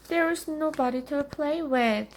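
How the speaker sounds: put-on high-pitched child's complaint; complaining little witch. A narrator's reading. Bored child complains to mother.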